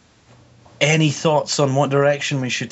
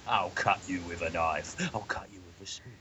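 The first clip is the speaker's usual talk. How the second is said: much softer, menacing Cockney; the Hitcher; 3 semitones lower, 14 dB quieter than usual. Retold or imitated. Podcaster imitates character's threats, hushed Cockney. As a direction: fade to a near-whispered mock threat. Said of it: "There's a character in that called the Hitcher".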